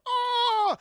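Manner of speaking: high, loud held cry; stabbed gasp. Retold or imitated. Jor-El stabbed in the tummy, high loud dying cry. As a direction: cry out as the blade goes in. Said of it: "he's like".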